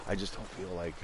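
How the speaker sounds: hushed voice; whispering. Real, not acted. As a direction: keep it down to a murmur. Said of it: "when I have to whisper like this".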